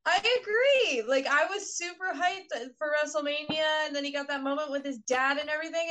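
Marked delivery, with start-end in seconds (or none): 0.1-4.0 excited agreement. Real, not acted. Joining the mock hype.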